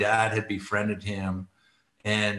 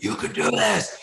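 higher, pushing encouragement; dad urging the scared kid, rising push; 5 semitones higher than usual. Retold or imitated. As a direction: coax him off the mountain. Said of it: "screaming in the guy's face".

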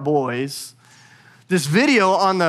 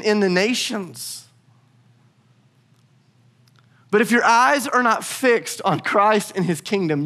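raised pitch, driving sermon delivery; impassioned preaching; 6 semitones higher than usual. Real, not acted. Preacher urging church that Jesus is at work, impassioned. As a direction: build a rousing list, phrase by phrase.

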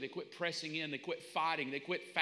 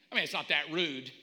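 normal talking voice

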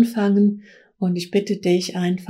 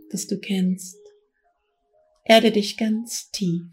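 guided meditation. Acted. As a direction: guide them gently into trance. Leads a guided trance journey.

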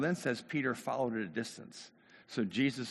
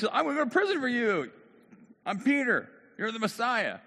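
loud, high, fervent declaration; hammed-up zealous pledge; bold vow; 11 semitones higher, 5 dB louder than usual. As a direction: proclaim loyalty at full voice. Acts Peter's bold vow.

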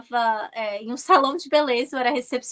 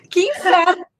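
higher, louder, laughing through it; laughing exasperation; 5 semitones higher, 6 dB louder than usual. Retold or imitated. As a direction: laugh at your own bad luck. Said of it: "Eu falo assim". ("que inferno" rindo, mais agudo e alto)

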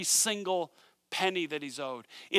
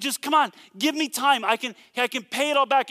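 higher and louder, a mild mock plea for time; panicked pleading; 7 semitones higher, 5 dB louder than usual. Choked debtor begging the servant for time, mock panic. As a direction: beg like he's pinned to the wall. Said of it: "this guy's freaking out".

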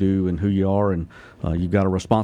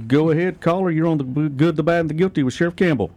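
bright announcer voice, pitched up; radio host announcer; 7 semitones higher than usual. Real, not acted. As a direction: introduce the caller like a DJ.

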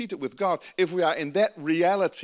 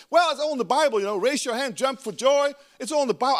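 high, loud mocking voice of a prim churchgoer; hammed-up pious critic; 7 semitones higher, 4 dB louder than usual. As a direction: parody the smug legalist, pitched up.

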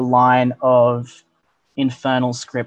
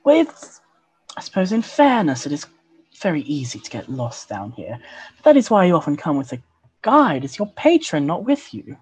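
higher, sultry elf woman; femme fatale; 8 semitones higher than usual. Acted. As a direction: purr it like a seductress. GM voices elven woman NPC.

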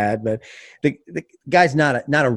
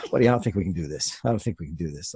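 quiet plea; 8 semitones lower, 7 dB quieter, faster than usual. Retold or imitated. Gently telling partner it fails.

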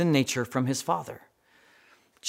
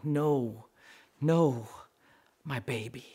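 mock sobbing; 2 semitones lower, 6 dB quieter, slower than usual. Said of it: "she fell to the ground sobbing".

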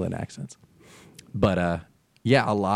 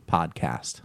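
flat mid-Atlantic podcast; podcast without the Jersey vowel; neutral accent; 6 semitones lower, 6 dB quieter than usual. Shown as a demonstration. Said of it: "kinda accent neutral".

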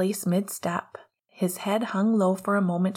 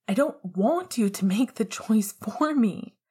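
raised, higher pleading scream; tearful scream at the boy he loves; 5 semitones higher, 4 dB louder than usual. A narrator's reading. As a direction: scream it through tears. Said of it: "he screamed".

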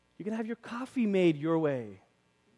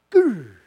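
short loud comic growl; playful loud grr; mock growl; 4 semitones higher, 12 dB louder than usual. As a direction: growl like an angry dog. Bible dart growl.

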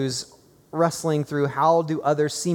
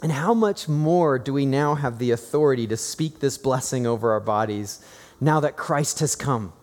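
normal talking voice

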